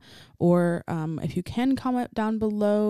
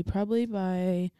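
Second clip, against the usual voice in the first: normal talking voice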